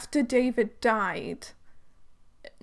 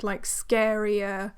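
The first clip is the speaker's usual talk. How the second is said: ordinary talk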